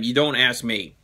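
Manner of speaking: ordinary delivery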